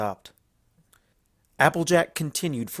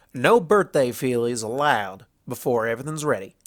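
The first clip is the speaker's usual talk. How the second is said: a stubborn country mare refusing help; Applejack voice; 4 dB louder than usual. Acted. Applejack turning down Luna's offer, folksy and firm.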